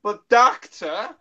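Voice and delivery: high, loud, drawn-out companion plea; Peri impression. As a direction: whine slowly at the Doctor. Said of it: "Here's my Perry and Doctor impression".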